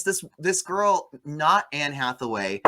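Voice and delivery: usual speaking voice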